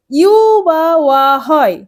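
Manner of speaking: ইউ and ওয়া slowly stretched out, one by one; YU or WA sounds. Shown as a demonstration. Teacher stating the vowel rule to students, ইউ and ওয়া slow and drawn out. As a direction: draw out "yuu" in ইউ and "waa" in ওয়া. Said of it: "এই ভাওয়েলগুলোর উচ্চারণ".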